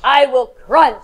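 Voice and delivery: loud, slow threatening shark; mean shark voice. Shown as a demonstration. Teacher models the shark's threat for repeating, mean. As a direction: snarl it slowly like a shark. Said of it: "In your best shark mean".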